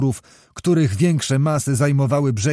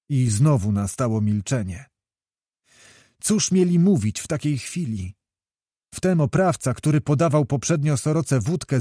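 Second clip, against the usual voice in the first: plain reading voice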